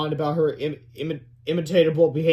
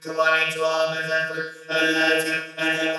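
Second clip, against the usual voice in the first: slow, monotone robot countdown; computerized voice; slower than usual. A narrator's reading. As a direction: count down like a machine. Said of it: "a computerized voice started".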